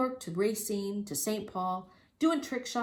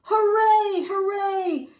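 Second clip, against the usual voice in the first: high, loud hooray; crowd cheering; 14 semitones higher, 11 dB louder, much slower than usual. A narrator's reading. Circus crowd greeting Lafcadio, high loud cheer. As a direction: cheer like the whole tent. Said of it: "And everyone went".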